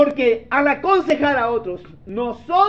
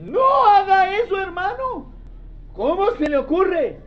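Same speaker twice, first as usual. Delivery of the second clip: shocked scolding; 10 semitones higher than usual. Retold or imitated. Preacher scolds a brother's bad plan, alarmed high cry. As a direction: cry out like he is about to ruin his life. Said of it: "Usted dice".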